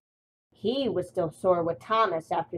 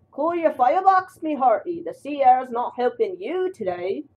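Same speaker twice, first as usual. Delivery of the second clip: higher, louder hammed-up old salt; sailor voice; 6 semitones higher, 5 dB louder than usual. Salty teases James at the docks, hammed-up sailor voice. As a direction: play a cheeky sea dog.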